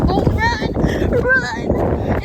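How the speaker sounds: high, loud playful yell; excited shout. Pretend. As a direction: shout it like a game. Playful shouting during photo.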